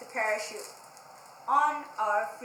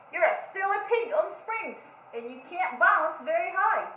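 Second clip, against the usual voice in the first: loud, higher teasing scold; mocking Parrot; 6 semitones higher, 7 dB louder than usual. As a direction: tease the pig from the branch. Parrot mocks Pig.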